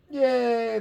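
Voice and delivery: high cheer. A narrator's reading. The crowd cheering for Pip. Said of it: "the crowd cheered".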